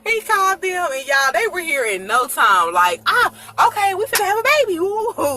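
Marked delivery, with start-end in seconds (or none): 3.0-5.4 excited relatives. Retold or imitated. Sister and mama arrive excited.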